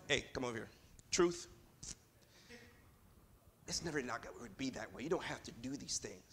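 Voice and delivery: low, hushed word in private; acted quiet, low murmur of counsel; confidential aside. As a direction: take him aside and talk him down softly. Acts Peter pulling Jesus aside.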